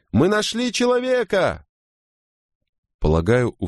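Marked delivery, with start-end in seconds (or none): 0.1-1.7 very high, sustained sailor's call; high-pitched call announcing a find; crying out. A narrator's reading. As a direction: hail the boats with a high, carrying cry. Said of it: "прокричал какой-то матрос".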